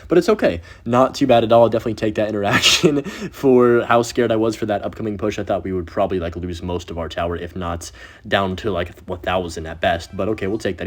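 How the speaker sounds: ordinary talk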